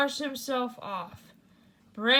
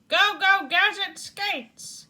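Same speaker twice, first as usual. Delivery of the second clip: shouting gadget command; 7 semitones higher than usual. Acted. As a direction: summon the skates with a ringing cry. Gadget calls his gadget.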